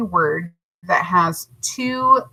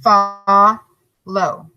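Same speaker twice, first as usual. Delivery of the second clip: "Fa, lo" said separately, "Fa" louder; syllables split apart; 4 dB louder than usual. Shown as a demonstration. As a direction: split "Fa, lo" for the class. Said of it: "we break in between".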